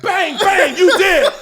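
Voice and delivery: loud, high mock gunfire taunt; hammed-up threat. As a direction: shout it like play-acted shooting, laughing. Acted threat in improvised fight.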